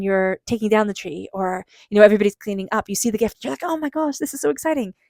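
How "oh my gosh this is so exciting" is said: mock excited gasp, pitched higher; gushing at a surprise present, lightly acted; imitating excitement. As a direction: play the delighted finder of a forgotten gift. Finding a gift after Christmas.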